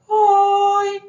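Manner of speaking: long, very high-pitched "hoi", hammed-up; silly sing-out shout, joking about anger; mock angry. Shown as a demonstration. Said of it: "Takkan kita nak marah". (pura-pura marah, suara sangat tinggi dan meleret)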